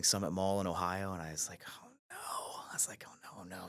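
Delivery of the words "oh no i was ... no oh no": hushed, worried oh no; under-the-breath panic; seeing Michael calling back, whispered dread. Retold or imitated. Dreading the return call.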